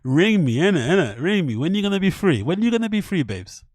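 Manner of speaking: a hammed-up roadman chatting up the voice clip; mock flirting with the British voice. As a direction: lay on the London slang flirting.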